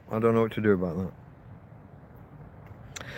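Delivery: quiet slow mutter. Real, not acted. Stuck with the wind on the mic, muttering quietly and slowly. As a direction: let it fade out, talking to yourself. Trailing off over the wind problem.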